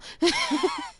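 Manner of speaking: laughs. Real, not acted. Streamer laughing at own joke.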